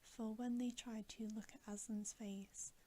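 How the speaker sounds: ordinary delivery, nothing marked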